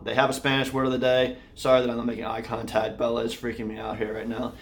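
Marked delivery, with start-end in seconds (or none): none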